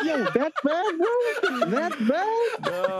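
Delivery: high, laughing "that bad, bro?"; laughing tease. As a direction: rib your mate through laughter. Teasing mate over broken screen.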